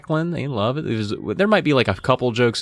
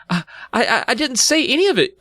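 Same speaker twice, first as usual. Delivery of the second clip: high, stammering denial; panicked protest; stammering girl; 14 semitones higher than usual. A narrator's reading. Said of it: "Chris stammered".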